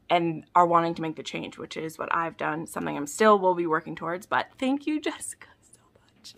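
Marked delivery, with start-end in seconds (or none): 4.3-6.3 hushed, heartfelt whisper; whispered thanks. Real, not acted. Fan thanking Jessica for the book, whispered thanks. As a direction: breathe the thanks.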